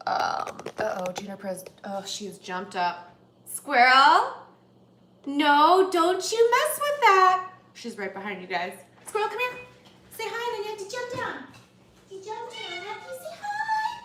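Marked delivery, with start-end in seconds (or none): 3.4-4.3 loud questioning call; raised-voice summons to a pet. Real, not acted. Calls out to pet jumping up. 5.3-7.5 loud, high drawn-out scolding; stretched-out high reprimand; scolding a pet. Real, not acted. Scolds pet near the food. 9.1-14.0 high-pitched coaxing for an animal; pet talk. Real, not acted.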